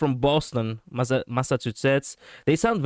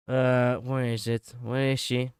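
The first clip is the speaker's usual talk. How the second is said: normal talking voice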